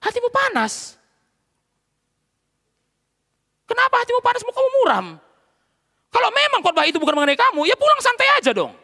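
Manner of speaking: very high, loud pulpit challenge; full-force preaching. Real, not acted. Pastor voicing God's challenge to the angry listener, full-force preaching. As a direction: push pitch and volume right up.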